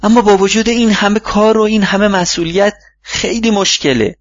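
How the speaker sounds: high-pitched plaintive whine; put-on groaning protest, pitched well up; moaning complaint. A narrator's reading. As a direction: moan it out, slowing at the end. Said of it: "مایکل نالید".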